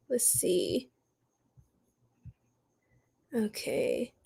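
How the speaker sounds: very soft, breathy whisper; whispered to self. Real, not acted. Reader checking the Libra cards, whispered to self. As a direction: whisper it absently under your breath.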